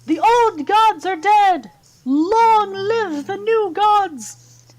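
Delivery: high, loud, drawn-out proclamation; ringing shouted chant, slow and high; town crier. Acted. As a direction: cry it out across a square like a town crier. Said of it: "almost like a town cry".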